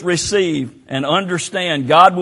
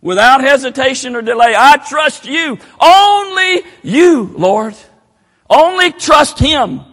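shouted appeal; 7 semitones higher, 5 dB louder than usual. Real, not acted. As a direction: shout the trust out, drawn long.

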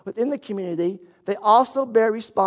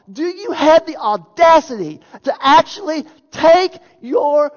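loud, higher, slow outraged preaching; indignant outrage; 5 semitones higher, 6 dB louder, slower than usual. Shown as a demonstration. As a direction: thunder it at the congregation, appalled. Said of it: "Paul is putting that there for emphasis".

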